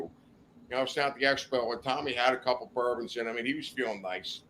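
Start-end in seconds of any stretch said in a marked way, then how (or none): none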